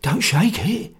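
high, frightened hoarse voice; hoarse whisper. A narrator's reading. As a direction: urge them in a hoarse, panicky undertone. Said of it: "he whispered hoarsely".

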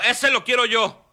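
grab the puppy you want, eagerly; eager claim. Retold or imitated. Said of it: "Y luego".

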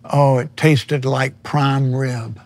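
usual speaking voice

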